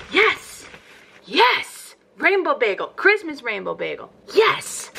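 high delighted yeses; squealing yes over a bagel; excited yes. Real, not acted. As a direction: cheer it up high. Excited about rainbow bagel.

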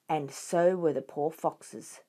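ordinary delivery

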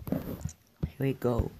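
soft slow whisper. Real, not acted. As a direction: breathe it quietly. Whispered start of play.

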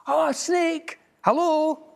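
high, bright squeak of a greeting; a tiny mouse waving hello; cheeky mouse greeting. A narrator's reading. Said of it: "mouse greets the snake".

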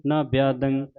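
slow, measured chanting of gurbani; recitation. Shown as a demonstration. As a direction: recite each line as a chant.

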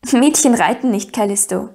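usual speaking voice

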